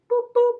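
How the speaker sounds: two high, loud boops; playful toot noise. Pretend. Seller playing with brass pot, playful toot noise. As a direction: pop out two high toy-whistle boops. Toots like a cartoon sailor.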